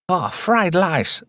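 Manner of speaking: high, slow mock Chinese accent; mock Chinese accent, drawn out. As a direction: drag out the words in a hammed-up Chinese accent. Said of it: "says Ash and Prism".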